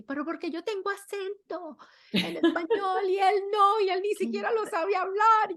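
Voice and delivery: put-on high, loud, put-upon girl's whine; exaggerated little girl's gripe; daughter's indignant complaint. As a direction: mimic the daughter's outraged protest. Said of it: "ella le da una rabia".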